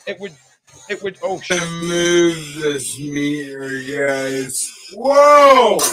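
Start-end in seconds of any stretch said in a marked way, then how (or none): 1.6-4.7 drawn-out slow-motion voice; slow motion replay. Shown as a demonstration. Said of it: "super slow motion". 4.9-5.8 high, loud drawn-out whoa; slow-motion shout. Shown as a demonstration. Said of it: "super slow motion".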